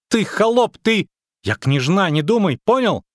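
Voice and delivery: put-on very high girlish outburst; haughty child squealing in outrage; indignant little princess. A narrator's reading. Little princess insulting the witcher, shrill indignation.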